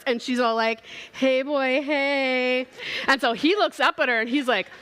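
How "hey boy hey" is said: flirty princess call. Retold or imitated. Princess calling down from tower.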